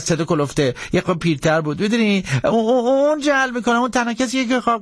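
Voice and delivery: high, stammering, anxious pleading; very frightened. Acted. Jackson blaming Hank to the marshal, frightened pleading. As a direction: plead up high, scrambling to explain. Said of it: "بسیار ترسیده بود".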